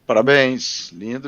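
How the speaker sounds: ordinary talk, nothing marked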